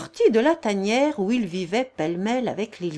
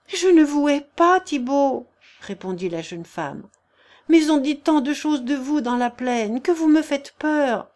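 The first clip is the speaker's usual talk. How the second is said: put-on timid young woman; 6 semitones higher, 5 dB louder than usual. Agnelette's voice.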